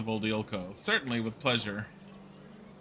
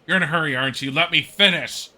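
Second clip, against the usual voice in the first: put-on louder, huffy old woman snapping back; indignant scold; 4 semitones higher, 9 dB louder than usual. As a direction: put the hasty investigator in his place. Old woman scolds the impatient detective.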